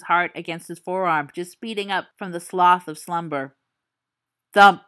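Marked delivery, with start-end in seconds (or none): 4.5-4.8 a sudden loud thump. Acted. Storyteller sounds the monster's thump, loud. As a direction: slam it out to startle.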